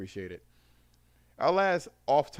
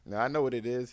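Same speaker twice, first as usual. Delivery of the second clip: ordinary talk, nothing marked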